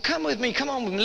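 pushy coaxing. Retold or imitated. Drunk man coaxes the girl.

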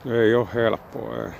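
talking around a cigarette; cigarette in mouth. Real, not acted. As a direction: lips gripping a cigarette. Seen 2: Unlit cigarette held between lips throughout the labelled words.

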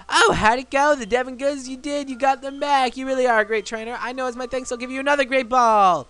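high, gushing, put-on voice; excited game character.

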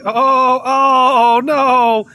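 high, drawn-out cry of dismay; real, loud disappointed outburst. Real, not acted. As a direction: cry out at a missed moment. Dismay at missing the shot.

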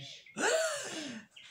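high, drawn-out gasp of horror; big theatrical gasp; shocked gasp. Acted. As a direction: suck in breath in storybook shock. Rabbit hears weasel's threat.

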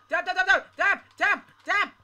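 high, loud frantic chant; terrified gibbering. Real, not acted. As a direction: yell it while mashing in panic. Frantic button mashing.

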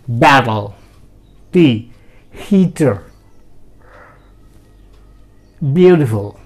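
"battle", "heater", "beautiful" with a crisp T sound; British pronunciation. Shown as a demonstration. Teacher demonstrates British T to Hindi-speaking students. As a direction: say each word with a clear British T. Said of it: "British pronunciation में मैं करता हूँ पहले".

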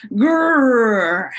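long, drawn-out "grrr"; tummy growl. Shown as a demonstration. Storyteller demonstrates a hungry stomach, long growl. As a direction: imitate a rumbling stomach, rolling it out slowly. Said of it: "when your stomach is growling".

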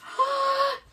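high-pitched intake of breath; big teacherly gasp; excited gasp. Pretend. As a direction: gasp with delight for small children. Teacher opening the lesson excitedly.

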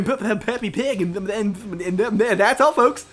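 Porky Pig stutter. Pretend. Gamer slips into a stuttering cartoon pig sign-off. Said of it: "you were talking like Porky".

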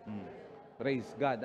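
normal talking voice

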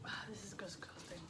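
whispered, grossed out; disgusted whisper. Real, not acted. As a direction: hush it out in disgust. Disgusted after tasting chips.

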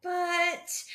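high, hesitant objection; fretting. A narrator's reading. Frank doubting amid the feast, fretting. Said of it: "fretted Frank".

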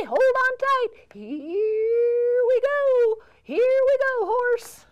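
loud, high whoop of excitement; excited ride off. Acted. Cowboy riding off on the horse, loud and thrilled. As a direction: call it out as the horse starts.

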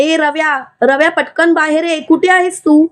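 usual speaking voice

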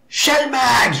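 high loud shaman shout; shouted name. A narrator's reading. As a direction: yell the name across the camp. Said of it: "hurla le chaman".

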